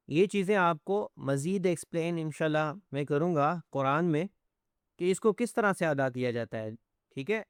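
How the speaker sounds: usual speaking voice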